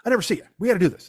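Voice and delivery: retold friendly insistence; insistent friend. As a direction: wave off the objection insistently. Friend insists on paying, acted.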